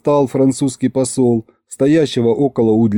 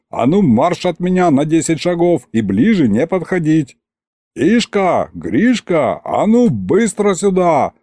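raised-pitch commanding calls; exasperated orders; 5 semitones higher than usual. Acted. Tsar ordering butler away and summoning helpers, exasperated. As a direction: order everyone about with irritation. Царь раздаёт команды.

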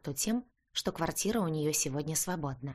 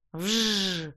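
zooming vroom noise; motorbike engine buzz; 3 semitones higher, 4 dB louder than usual. Acted. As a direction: buzz like a revving bike. Motorbike roars up.

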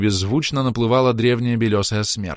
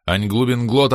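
usual speaking voice

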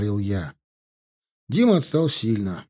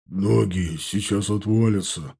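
wheezing, slow and winded; out of breath; 4 semitones lower than usual. Acted. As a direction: gasp it out after running. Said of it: "задыхаясь, прохрипел Вася".

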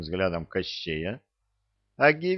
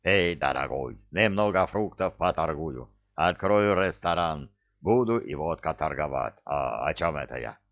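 put-on accented southern trader, easygoing sales patter; Givi, chatty comic huckster; 3 semitones lower than usual. (Гиви, болтливый торговец с кавказским акцентом)

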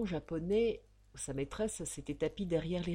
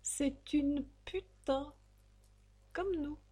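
higher, slow woman's voice; cynical courtesan voice; 8 semitones higher, slower than usual. Acted. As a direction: drop the verdict with weary irony.

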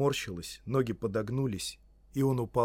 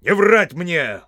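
loud, slow, raised command; severe, forceful rebuke; stern cutting-off; 8 semitones higher, 12 dB louder, slower than usual. Acted. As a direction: cut the liar short like a stern wizard. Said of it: "сурово оборвал его маг".

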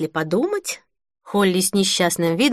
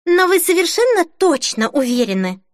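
higher, anxious-to-hear-yes question; insistent; 9 semitones higher than usual. A narrator's reading. Denise refusing to let the question drop, insistent. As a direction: keep pressing, pitch raised. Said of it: "не унималась Денис".

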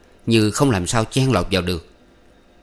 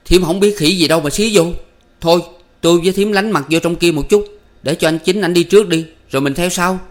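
higher, louder grumbling; grimacing annoyance; 8 semitones higher, 5 dB louder than usual. A narrator's reading. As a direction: brush Thiểm off with irritation. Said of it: "nhăn nhó vai qua bảo Thiểm".

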